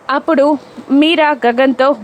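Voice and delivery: usual speaking voice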